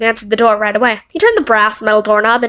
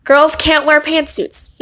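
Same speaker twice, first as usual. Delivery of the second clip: ordinary talk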